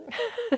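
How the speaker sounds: short high giggle; a quick embarrassed giggle. Real, not acted. Laughs at own disorganisation.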